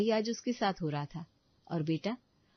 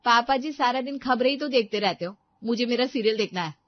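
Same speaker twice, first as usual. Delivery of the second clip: put-on loud, higher, sneering young wife; haughty daughter-in-law; 6 semitones higher, 8 dB louder than usual. Daughter-in-law to mother-in-law, haughty and loud. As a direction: put the old woman in her place.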